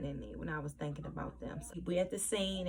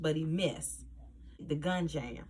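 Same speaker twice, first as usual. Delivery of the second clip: normal talking voice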